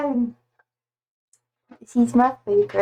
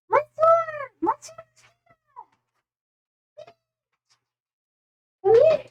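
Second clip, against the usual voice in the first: calling the cat. Real, not acted.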